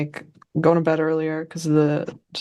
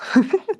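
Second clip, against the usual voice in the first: high laugh; 13 semitones higher, 3 dB louder than usual. Real, not acted. Laughs about her two losses.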